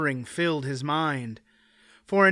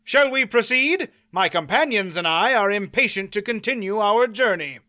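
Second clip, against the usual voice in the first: raised, breezy challenge; cheerful; 6 semitones higher, 4 dB louder than usual. A narrator's reading. Knight cheerfully urges the baron to proceed. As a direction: press him along with mock good cheer. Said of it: "he said cheerfully".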